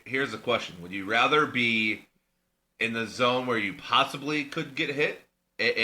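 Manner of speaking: ordinary delivery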